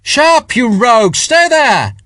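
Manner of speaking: loud, high call across the square; shout from a balcony. A narrator's reading. Spiers shouting down to Sharp from a balcony, loud. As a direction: yell down from the balcony. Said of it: "there was a shout from one of the upper balconies".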